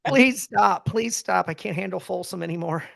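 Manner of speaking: high, mock pleading "Please stop", fading out. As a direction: beg him to stop, half joking. Grandmother begging on her deathbed.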